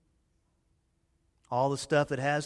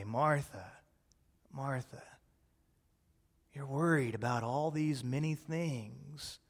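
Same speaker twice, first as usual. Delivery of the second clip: loving way; 2 semitones lower, 4 dB quieter than usual. Retold or imitated. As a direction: call her back tenderly, no rebuke at all. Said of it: "I think he said it in a loving way".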